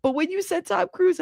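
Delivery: ordinary delivery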